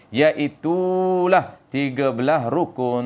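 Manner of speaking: "Iaitulah" drawn out before "tiga belas rukun"; slow recitation, holding "Iaitulah" and "rukun"; Tok Guru intonation. Shown as a demonstration. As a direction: stretch "Iaitulah", then let "rukun" come to a lingering stop. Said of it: "Ha, kena berhenti".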